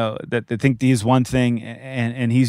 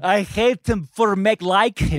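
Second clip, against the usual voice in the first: put-on teasing Czech accent, pitched higher; mock Czech accent; 8 semitones higher than usual. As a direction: parrot the line in a Czech accent, laughing at it. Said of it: "the Czech Republic".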